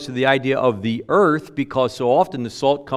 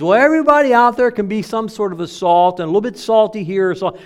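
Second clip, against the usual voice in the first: higher, louder run-on voice for a loose view; mock objector; 7 semitones higher, 4 dB louder than usual. Preacher parodies a broad reading before rejecting it, mocking. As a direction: toss it out high and unbroken, as someone else's notion.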